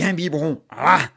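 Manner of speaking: loud, punchy là with each bottle; loud there. Acted. Mother cramming bottles into the monster, loud là.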